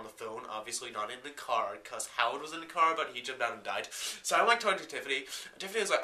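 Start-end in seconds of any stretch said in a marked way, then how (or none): none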